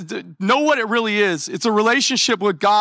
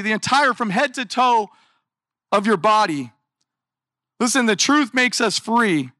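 ordinary delivery

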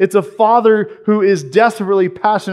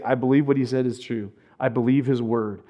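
quiet faith; 5 semitones lower, 7 dB quieter than usual. Retold or imitated. Man's quiet inner faith.

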